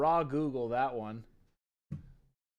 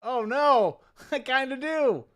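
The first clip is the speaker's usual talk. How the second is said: laughing realization; 12 semitones higher, 7 dB louder, slower than usual. Real, not acted. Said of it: "I just heard it".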